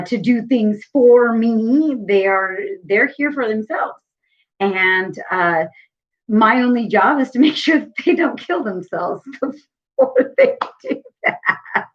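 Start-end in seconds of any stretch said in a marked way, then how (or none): 6.3-11.9 high, laughing through the words; rueful laugh. Real, not acted. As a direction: laugh ruefully while talking. Laughing through joke about kids.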